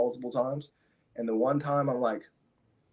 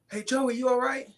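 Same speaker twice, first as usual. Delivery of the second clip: much higher calling voice of a worried dad; concerned neighbor dad; 13 semitones higher than usual. Retold or imitated. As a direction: call out to check he's okay, pitched way up. Friend's dad checking after crash.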